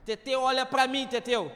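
loud call across the room. Real, not acted. Pastor calling a congregant, loud call. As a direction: call him from across the church.

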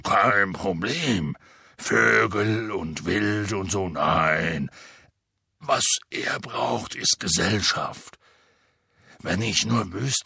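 deep rough half-giant voice; low booming gamekeeper; Hagrid voice. Acted. Hagrid explains need.

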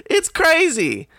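high, drawn-out cry of disbelief; incredulous. Real, not acted. Customer ranting about what Starbucks is like, drawn-out disbelief. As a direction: stretch "crazy" out, pitch way up. Repeats exclamation, stretched out.

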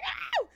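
high squealed howl; short mock scream, very high; imitated fan scream. Friend screaming for her favorite member, imitated squeal. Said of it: "[name] was screaming for [name]".

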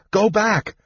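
high, urgent order to retreat; fearful cry. A narrator's reading. Palin sends kender back inside, crying fearfully. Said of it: "Palin cried fearfully".

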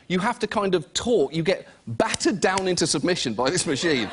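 usual speaking voice

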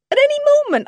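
high, loud repeat; desperate insistence. A narrator's reading. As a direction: insist on it frantically, pitched high. Wife insists husband will return.

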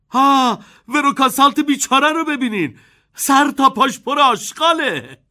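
put-on loud high boyish shout. A narrator's reading. Charlie shouting at the sight of Veruca. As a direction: call out in alarm. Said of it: "چارلی فریاد زد".

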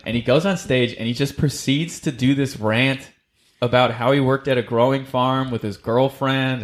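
usual speaking voice

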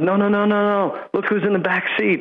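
mock the agent frantically. Mocking the agent in a TV episode.

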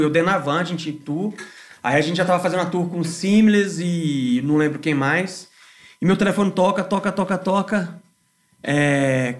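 usual speaking voice

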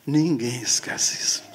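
hushed whisper. Acted. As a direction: breathe it almost silently. Repeated line, whispered.

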